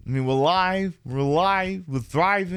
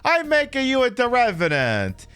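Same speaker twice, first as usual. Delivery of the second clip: hammed-up Italian mama, higher pitch; mock Italian accent; 10 semitones higher than usual. Streamer's pretend Italian mother describing the skeleton, hammed-up Italian accent. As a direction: lay the fake Italian accent on thick. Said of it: "Totally accurate Italian accent".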